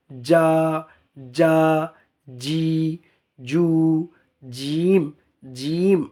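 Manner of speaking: যা, জি, জু, জিম with mid-tongue pressed to the palate; deliberate, isolated j sounds, জিম held out; dense jim articulation. Shown as a demonstration. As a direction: drill each j syllable firmly and separately. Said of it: "জিমের উচ্চারণটা এইভাবে হতে হবে".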